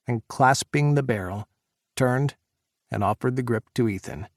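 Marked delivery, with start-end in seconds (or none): none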